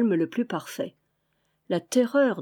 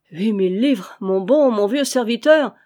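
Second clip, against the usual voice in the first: loud, raised-voice coaxing; eager exclamation; 2 semitones higher, 6 dB louder than usual. A narrator's reading. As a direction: cry out the offer to win him over. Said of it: "s'écria Vaunois".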